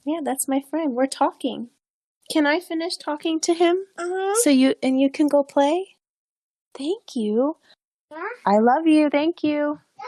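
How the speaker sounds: sweet but firm, shooing a little one away. Real, not acted. Mother asking her daughter to let her finish talking, affectionately. Mother sending child off to play.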